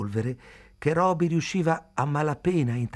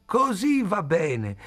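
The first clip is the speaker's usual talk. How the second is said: high, slow cheer of approval; delighted approval; 9 semitones higher, 3 dB louder, slower than usual. A narrator's reading. Old man applauding May's singing, delighted.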